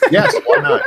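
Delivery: high laughing reply. Real, not acted. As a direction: squeal it through laughter. Laughing at joke.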